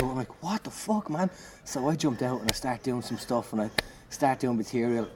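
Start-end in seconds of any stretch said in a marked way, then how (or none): 0.4-1.3 high-pitched incredulous protest; imitated alarmed disbelief. Comedian is told to go back out by the friend, high incredulous protest.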